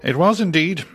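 usual speaking voice

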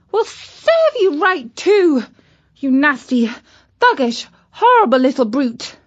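high indignant telling-off; indignant scolding. Acted. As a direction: scold the nasty little brute. Katy scolds the virus.

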